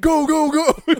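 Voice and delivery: high, loud barked command to the guards; shouted order. Acted. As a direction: yell it at your security detail.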